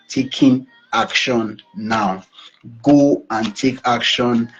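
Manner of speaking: slow, weighty insistence; slow, emphatic call to act; emphasizing. Real, not acted. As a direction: spell out each word slowly. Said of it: "I'm emphasizing this".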